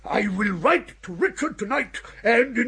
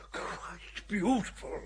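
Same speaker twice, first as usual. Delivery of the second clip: awed whisper; 13 dB quieter than usual. Acted. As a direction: breathe it out, slow and soft. Overcome by the sentiment.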